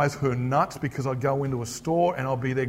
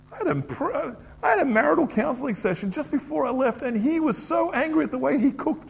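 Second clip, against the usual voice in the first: usual speaking voice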